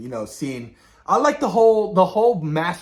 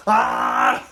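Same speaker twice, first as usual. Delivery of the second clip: mock strain; 8 semitones higher, 4 dB louder than usual. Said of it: "And Reed's like".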